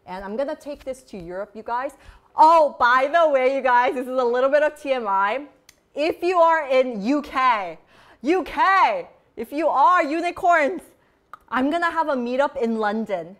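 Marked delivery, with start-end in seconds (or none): 2.3-13.4 loud, excited announcement; raised voice, sudden big announcement; calling out. Real, not acted. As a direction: call out to all the fans. Calls out to UK fans.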